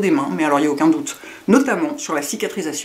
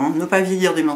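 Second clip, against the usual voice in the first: ordinary talk, nothing marked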